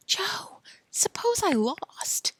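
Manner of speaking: worried high whisper; anxious whisper. Acted. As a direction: breathe the fear out.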